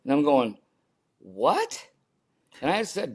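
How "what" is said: rising, higher-pitched startled question; incredulous. Retold or imitated. Son hearing dad's Alaska plan, incredulous. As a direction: act out a disbelieving double take.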